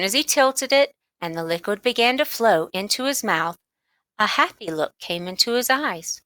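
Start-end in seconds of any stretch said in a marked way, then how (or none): none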